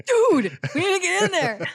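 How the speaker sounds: loud, high laid-back surfer dude. Pretend. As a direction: call out like a stoked surfer. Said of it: "we all talk like surfer dudes".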